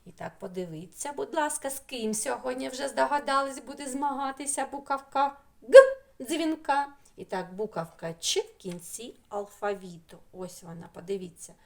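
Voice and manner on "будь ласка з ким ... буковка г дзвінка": ordinary talk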